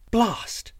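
high-pitched princess exclamation; vexed princess. Acted. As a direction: curse primly in a high princess voice. Princess vexed at failed search.

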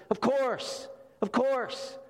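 high sarcastic of course, of course; tossed-off ironic agreement; mock concession. Preacher mocks the human-level view, sarcastic.

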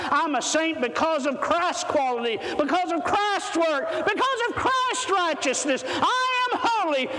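rising sermon crescendo ending in a shout; building preach. Acted.

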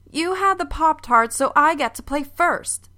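put-on high, annoyed child's complaint; a girl griping that it's her turn; pissed girl. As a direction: complain like a cross little sister. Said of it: "Elizabeth sounds pissed".

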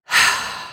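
long breathy storybook sigh; heavy acted sigh of defeat; stumped sigh. A narrator's reading. Cannot think of an idea.

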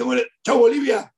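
loud, high, breezy farewell; imitating the father's cheery goodbye; cheerful goodbye. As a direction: call out a hearty goodbye. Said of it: "Osvaldo se mató de la risa".

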